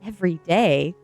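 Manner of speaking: loud, incredulous echo; horrified disbelief. A narrator's reading. Child hears school is every day, horrified disbelief. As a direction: repeat it as a dismayed question. Child echoes mom in horror.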